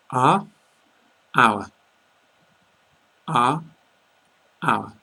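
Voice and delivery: are short, our longer and rounder; are versus our. Shown as a demonstration. Teacher asking learners to listen to the pair, clearly. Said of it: "listen to this".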